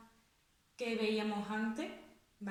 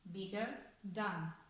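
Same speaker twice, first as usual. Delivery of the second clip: "Bigger than" dicho muy despacio y suave, marcando cada sílaba; pronunciación modelo lenta; 3 semitones lower, 6 dB quieter, much slower than usual. Shown as a demonstration. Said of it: "Le duplico la consonante". (profesora pronuncia "Bigger than" muy despacio)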